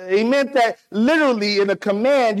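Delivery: usual speaking voice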